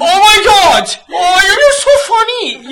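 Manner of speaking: high, loud, laughing fake adoration; mock gushing. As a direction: gush over a joke like a smitten admirer, hammed up. Mimics gushing reaction to attractive person.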